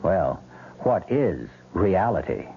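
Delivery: ordinary delivery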